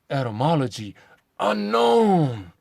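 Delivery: hammed-up pronouncement, leaping high on the last word; silly mock-official voice. Mocking mainstream scholars on the word's origin, silly pronouncing voice. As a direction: put on a ridiculous pompous delivery. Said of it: "Who talks like this?".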